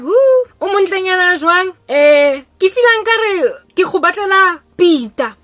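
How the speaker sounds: high, loud cooing mother; mother picks the girl for her son Peter, high and loud; gushing matchmaker mom. Retold or imitated. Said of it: "she's like".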